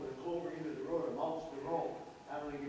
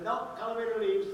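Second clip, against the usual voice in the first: raised, stubborn no; loud defiant reply; 7 semitones higher, 8 dB louder than usual. Retold or imitated. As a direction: push back loudly and firmly. Retold stubborn reply to adviser.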